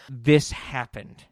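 usual speaking voice

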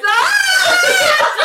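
high, loud laughing scream; a hammed-up shriek breaking into laughs; mock scream. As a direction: shriek then dissolve into laughter. [name] screams at the fight.

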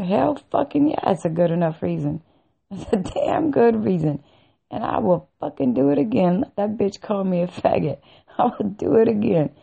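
emphatic retold comeback with laughs breaking in; gleeful defiance. Answering the psychiatrist who asked why, gleeful defiance.

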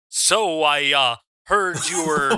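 half-hearted go at a wrestling star's voice; John Cena. Acted. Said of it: "lame attempt at being John Cena".

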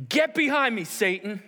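loud, high, explosive command; sudden forceful banishing order; stern rebuke. Acted. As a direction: thunder it at him as Jesus. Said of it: "Jesus goes".

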